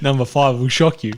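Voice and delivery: teasing hype voice, said through a laugh; clickbait video narrator. Pretend. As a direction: tease it like a clickbait headline. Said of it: "Should we do top five seasons".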